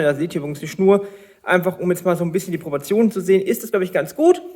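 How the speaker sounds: ordinary talk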